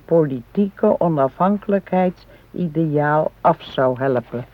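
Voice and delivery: careful, deliberate reading from a book; reading aloud. Acted. Wife reads a history book aloud to her husband. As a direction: read the passage out to her husband.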